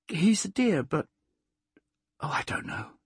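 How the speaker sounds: high start, sinking to a whisper; sighing, unsure. A narrator's reading. Plaxy asked about Robert, sighing uncertainly. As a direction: let it fade into a whispered sigh. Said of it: "and then sighed".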